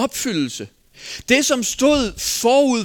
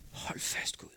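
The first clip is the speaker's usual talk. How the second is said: hushed, whispered exclamation; awed whisper; 17 dB quieter than usual. Retold or imitated. Preacher thinking to God at what he reads, awed whisper. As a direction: whisper it as an overwhelmed private thought.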